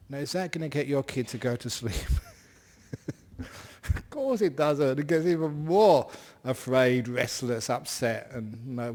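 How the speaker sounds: ordinary talk, nothing marked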